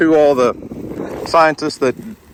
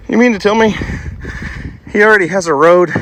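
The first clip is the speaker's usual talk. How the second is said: out of breath; 7 semitones higher than usual. Real, not acted. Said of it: "I am out of breath".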